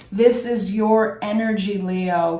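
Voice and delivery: ordinary talk, nothing marked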